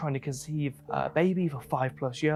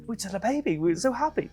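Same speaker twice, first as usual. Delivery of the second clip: lightly voiced happy couple, pitched higher; happy couple quoted; 9 semitones higher than usual. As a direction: chirp it out like proud new parents. Couple answering ISIS fighters.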